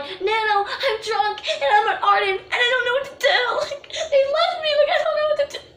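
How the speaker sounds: high, tearful, laughing through sobs; high crying voice breaking into laughs; drunk crying call. Retold or imitated. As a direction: wail it down the phone, drunk and panicking. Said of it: "laugh cry".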